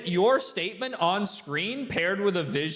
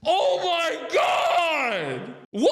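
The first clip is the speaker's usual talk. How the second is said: stretched-out, high and loud disbelief; shocked shout; 9 semitones higher, 4 dB louder, much slower than usual. Real, not acted. Host reacting to the referee's call, stunned shout. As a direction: blurt it out, floored.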